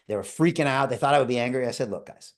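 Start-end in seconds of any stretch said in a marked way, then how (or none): 1.9-2.3 calm dad. Retold or imitated. Calming scared sons after breakage.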